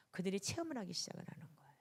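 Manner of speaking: quick hushed whisper; fast whisper. Real, not acted. Preacher whispering to the congregation, fast and hushed.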